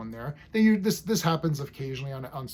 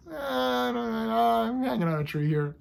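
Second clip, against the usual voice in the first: Spock; 8 semitones higher, 4 dB louder than usual. Retold or imitated. Spore-happy officer hanging on a tree, imitated carefree. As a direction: stretch it out high, playfully imitating someone blissed out.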